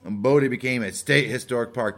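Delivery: normal talking voice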